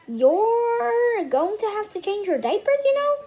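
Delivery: teasing warning. Acted. Bean warns Ivy of trouble.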